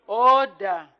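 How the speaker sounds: loud shouted order; shouting order. Real, not acted. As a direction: shout it over the House. Speaker calls order.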